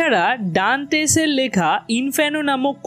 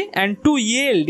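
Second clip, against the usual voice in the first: very slow, "yield" stressed at the end; drawn-out and to, then a strong yield; much slower than usual. Shown as a demonstration. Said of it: "ইয়েল্ডটাকেও আমরা জোরে বলছি". (খুব ধীরে, "yield" শব্দে জোর)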